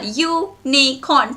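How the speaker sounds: U-NI-CORN slowly, syllable by syllable; broken into syllables. Shown as a demonstration. Teacher recaps the three syllables, U - ni - corn slowly split. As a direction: draw Unicorn out in three beats. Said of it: "Thrice your jaw hits your hand".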